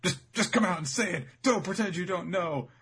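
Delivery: high, stammered insistent outburst; hammed-up flustered accusation; demanding Baby Five. Acted quarrel, Baby Five demanding.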